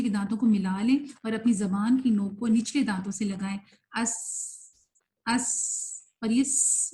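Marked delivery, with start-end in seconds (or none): 4.0-6.9 long sibilant hiss ending اس; whistling s. Shown as a demonstration. Teacher checking articulation for students, sustained whistling س. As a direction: hold the س as a thin steady whistle. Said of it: "سیٹی کی آواز ہے".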